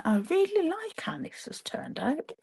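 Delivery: pleased whispered aside. Real, not acted. Admiring her own finished card, whispered.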